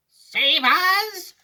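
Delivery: high, loud wail; yell for help. A narrator's reading. Grandma yelling to be saved, high and loud. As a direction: scream out in alarm. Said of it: "yelled Grandma Georgina".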